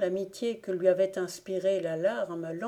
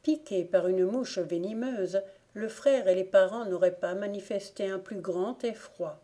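ordinary delivery, nothing marked

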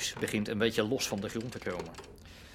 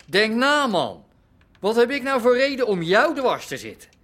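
higher and louder, protesting; indignant outburst; 10 semitones higher, 7 dB louder than usual. Acted. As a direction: push back hard, offended. Heated denial in a quarrel. (verontwaardigd uitvallen)

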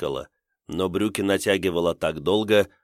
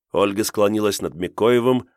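usual speaking voice

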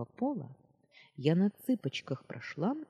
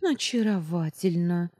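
ironic; 3 semitones higher, 6 dB louder than usual. A narrator's reading. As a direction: exclaim it with mock delight. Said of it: "иронично заметила я".

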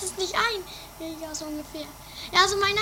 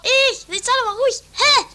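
louder, impatient snapping; exasperated, raised voice; annoyed outburst; 5 semitones higher, 7 dB louder than usual. Acted. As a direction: cut her off, fed up. Annoyed at friend on the phone.

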